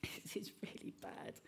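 mock-despairing whisper. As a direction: whisper it like you've given up. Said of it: "walking around saying".

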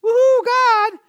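high, loud mock fan whoop; fan cheer. Pastor mocking fans cheering for God, high and loud. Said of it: "wants more than just fans".

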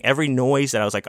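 usual speaking voice

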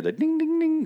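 a high ringing ding-ding, sung like a service bell; mock bell dings pitched far up; bell imitation. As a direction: chime it high like a tiny bell. Imitating Hector Salamanca's bell.

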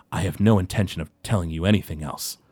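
lightly voiced dwarf, low and soft; a hint of a weary, hurt old dwarf; quiet, subdued dwarf speaking from the doorway; Goldbeard.

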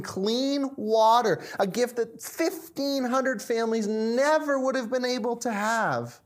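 high, earnest, swelling testimony; impassioned explanation. Acted. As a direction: build with heartfelt conviction about the work. Jim passionately explains his purpose.

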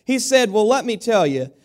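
high, loud, lively joke telling to the congregation; animated joke telling. Acted. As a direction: sell the joke with big energy. Preacher tells a joke from the pulpit.

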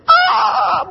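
high mock wail of happy tears; overwhelmed joy. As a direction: imitate an overcome, high-pitched "ah". Retold tearful reaction to more gifts.

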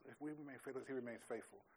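meditative musing. Retold or imitated. Pastor showing how he meditates on a verse, hushed and musing. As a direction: read it quietly to yourself and wonder. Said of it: "so this is".

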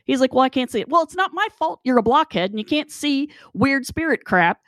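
high-pitched, stumbling insistence; exasperated protest. Retold or imitated. Arguing with Gary over blue flashes, exasperated. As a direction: argue frantically with someone who can't see it. Acted argument with disbelieving Gary.

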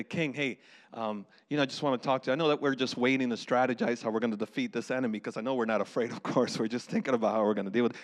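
low, soft, tiptoeing round the point; hesitant messenger. Acted. Soldier brings news to King Saul, hesitant. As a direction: play a wary underling breaking news gently.

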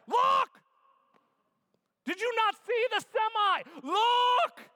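acted parent's panicked yell; high, loud yell to children near traffic; yelling at kids. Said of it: "what you yell at your kids".

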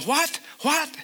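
sudden high what, then a drop; mother hearing the worst on the phone; shocked what. Retold or imitated. Wife on the phone with her daughter-in-law, a mild imitated shock.

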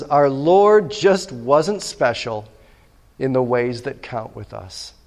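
slowly, each word weighed; slow quoted line. Shown as a demonstration. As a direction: read the quote slow and deliberate. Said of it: "He says".

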